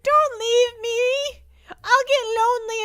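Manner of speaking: very slow, high wailing plea; hammed-up whimpering beg; mock crying plea. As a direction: drag it out like a sobbing child. Begging viewers not to leave.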